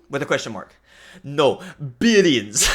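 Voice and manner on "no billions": put-on slow, drawn-out accented "No, billions"; laughing imitation of the professor's accent; mock accented teacher. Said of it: "I just said exactly what he sounded like".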